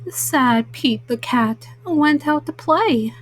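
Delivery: ordinary delivery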